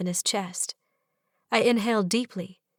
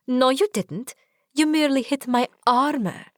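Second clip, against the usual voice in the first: playful protest; 5 semitones higher, 3 dB louder than usual. Pretend. Playful protest in pretend fight.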